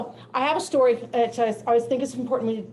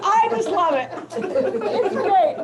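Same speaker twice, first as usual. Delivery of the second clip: much higher and louder, bursting with joy; delighted exclaim; 9 semitones higher, 5 dB louder, much slower than usual. Retold or imitated. Teacher gushing over her student's reply, high and loud. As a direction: squeal it out with glee. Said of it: "And I went".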